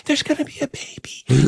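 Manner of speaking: very high, delighted squeal; sudden squeal of joy. Retold or imitated. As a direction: flip to giddy joy in an instant. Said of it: "Like that".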